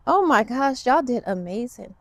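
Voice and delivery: normal talking voice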